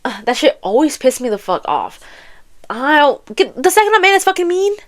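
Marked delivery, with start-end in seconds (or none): none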